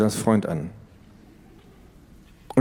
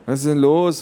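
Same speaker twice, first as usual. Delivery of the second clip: put-on boy's voice; 6 semitones higher, 5 dB louder than usual. Brother asks.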